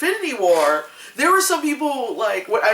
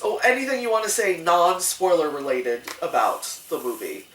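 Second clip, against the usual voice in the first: normal talking voice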